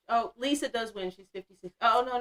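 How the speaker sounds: usual speaking voice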